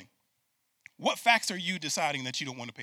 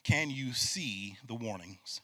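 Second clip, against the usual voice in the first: slow and deliberate, each word set apart; slow, weighty question; 3 semitones lower, 4 dB quieter, much slower than usual. Shown as a demonstration. Pastor asks the congregation the key question slowly. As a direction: make them hear the warning, slowly. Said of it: "Say that with me".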